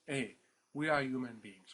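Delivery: ordinary talk, nothing marked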